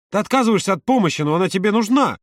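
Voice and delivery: high-pitched, insistent outburst; heated protest. A narrator's reading. Володя insisting Юра needs help, heated. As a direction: argue back with rising frustration.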